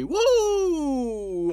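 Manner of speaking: hoarse cracking whoop; cracked voice. Real, not acted. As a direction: whoop with a broken voice. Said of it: "J'ai la voix cassée".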